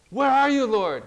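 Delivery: loud, high cry that drops on the last word; calling out to God. Acted. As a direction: cry out as if searching for the Lord. Preacher voices believer calling to God.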